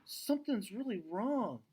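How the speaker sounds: a lightly voiced anxious daughter; worried girl voice.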